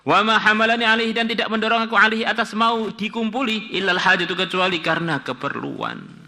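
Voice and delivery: high, loud reading of Arabic with translation; pembacaan kitab. Acted. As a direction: carry the quoted words high and forceful. Membaca kitab, ucapan wanita. (membaca kitab dengan suara tinggi dan lantang)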